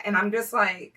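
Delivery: ordinary talk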